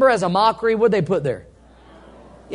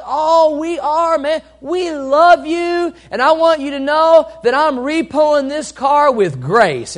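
higher, syrupy fake kindness; a hint of a sugary con man; mock-sweet repo man; 5 semitones higher than usual. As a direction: sell the friendly repo man, lightly. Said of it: "he said".

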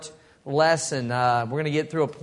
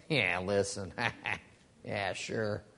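low, soft, scoffing disbelief; dismissive townsfolk; 8 semitones lower, 7 dB quieter, slower than usual. Retold or imitated. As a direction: brush him off, not buying it. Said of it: "And everybody's like".

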